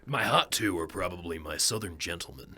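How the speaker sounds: low southern gentleman voice; deep-voiced, courtly Southern character. Shown as a demonstration. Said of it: "has a voice way down here".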